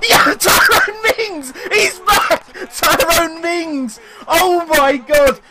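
high, slow, laughing cheer; laughing joy. Real, not acted. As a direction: laugh out the scorer's name. Celebrating Mings goal.

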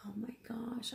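breathless whispered dismay; hushed gasp at the scratches; shocked whisper. Real, not acted. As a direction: whisper it in disbelief. Upset unboxing, seeing scratches.

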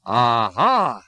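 put-on a loud drunk's roar; a drunk roaring at a foreigner on the train. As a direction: roar it like a drunk spoiling for a fight. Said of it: "he roared".

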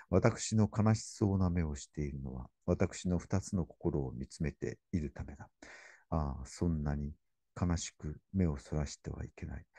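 soft, grave recital; quiet poem reading. Acted. Lecturer reading the poem's last words, soft grave reading. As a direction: read the closing lines tenderly. Reads final poem lines.

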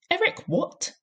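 a headmistress mocking a boy's name; shouted question. A narrator's reading. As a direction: shriek the question in disbelief. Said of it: "the Trunchbull shouted".

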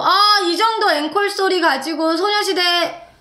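teasing encore call to the audience; imitated concert MC. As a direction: work the crowd like an event host. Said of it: "엠씨가 나와가지고".

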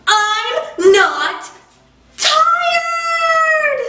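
tantrum shout. Acted. Pigeon screams at the child that he is not tired. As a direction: shout it like a stomping toddler.

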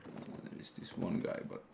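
whispered aside; half-whispered, almost to himself; quiet. Real, not acted. Quiet aside while playing.

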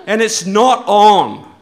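slow, loud, hammed-up indignation; mock outrage. Speaker to the hate-list crowd, in mock loud outrage. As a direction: drag it out like a wounded protester. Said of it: "and I'm upset".

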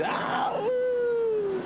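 a sudden shrill falsetto woo; excited whoop. Acted. Dude whoops on arriving in Minnesota, high falsetto. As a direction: whoop it out like a thrilled party dude.

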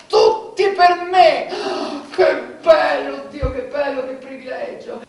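loud, high, slow gloating joy; ecstatic. Acted. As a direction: savor every word with glee. Ecstatic over daily pay.

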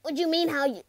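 loud, high exasperation ending in a groan; loud frustrated protest; exasperated outburst. Acted. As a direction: cry out in disbelief, then groan. Exasperated at the other's confusion.

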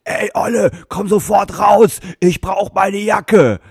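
put-on loud, high, slow drunk bellow; imitating a drunk demanding his jacket; drunk yelling. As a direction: bark it into the phone like a furious drunk. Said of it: "das Telefonat war dann so".

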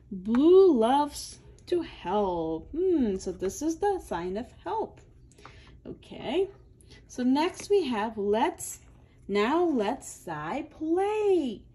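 normal talking voice

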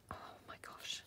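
breathy awed whisper; delighted whisper. Real, not acted. Crafter spotting the pretty blue piece, awed whisper. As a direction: gasp it softly in delight.

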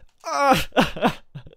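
high, loud laugh breaking into a fake sob; mock crying laugh. Gamer laughing at the car price, mock crying laugh. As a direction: laugh it off like a pretend cry. I'm actually gonna cry.

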